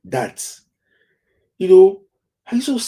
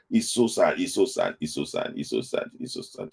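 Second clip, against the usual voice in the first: sad fade; 6 semitones lower, 4 dB quieter than usual. Real, not acted. Repeated lament fading out.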